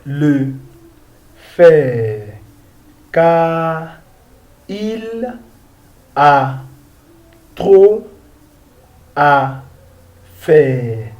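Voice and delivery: slow French, long pauses between words; deliberate, spaced-out French reading; word-by-word model reading. Shown as a demonstration. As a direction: read it out for learners, one word at a time.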